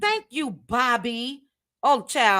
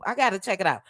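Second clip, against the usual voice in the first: ordinary talk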